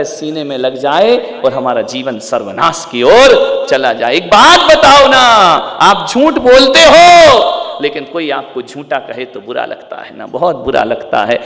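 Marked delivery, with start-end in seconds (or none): 4.1-7.4 high, loud question thrown at the crowd; loud cry with a long, drawn-out ending; shouted challenge. Acted. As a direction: confront the congregation at full voice.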